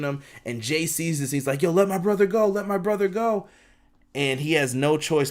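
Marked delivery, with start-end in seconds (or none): 1.6-3.5 high-pitched acted-out plea; mock urgent cry, pitched up. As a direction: imitate a desperate brother demanding release. Acted brother begging for release.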